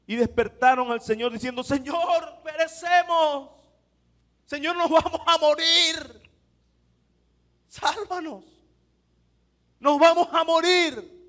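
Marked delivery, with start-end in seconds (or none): 1.6-11.2 desperate plea. Retold or imitated. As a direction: cry out like men about to drown. Discípulos gritan en la tormenta. (súplica desesperada de los discípulos en la barca)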